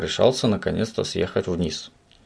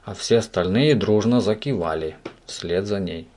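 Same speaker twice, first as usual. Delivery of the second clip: ordinary delivery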